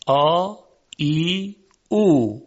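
آ ای او held long; Arabic long vowels. Shown as a demonstration. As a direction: stretch each long vowel. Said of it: "صداهای کشیده".

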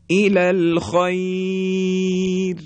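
الی الخیر drawn out slowly, the ر light and thin; slow melodic recitation; recited again. Shown as a demonstration. Teacher asks students to listen closely, slow chant.

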